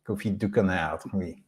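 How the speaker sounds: put-on a light, playful French accent on Confit du canard, oui; mock French accent. Joking to viewers about the local duck, in a mock French accent. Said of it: "See, I can do the French accent".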